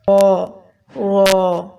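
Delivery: رو full-mouthed, a thick rounded "raw"; heavy ra. Shown as a demonstration. Teacher models the letter, thick heavy رو. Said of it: "خو کی طرح ہم پڑھیں گے موٹا".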